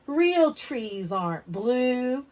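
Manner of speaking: put-on loud, slow, teasing kid; mocking classmate. Andrew jeering at Sophie's blue tree, loud and slow. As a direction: jeer it at her across the table.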